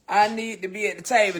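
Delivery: ordinary talk, nothing marked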